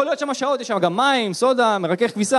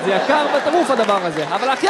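ordinary delivery

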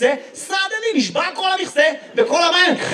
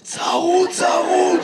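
hoarse; 5 semitones higher, much slower than usual. Real, not acted. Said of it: "למה אתה נשמע ככה".

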